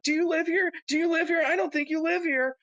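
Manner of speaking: high, nagging Karen voice; high mocking Karen demanding if he lives here. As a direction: shove it in their face like an entitled Karen. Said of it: "dressed up as, like, a fucking Karen".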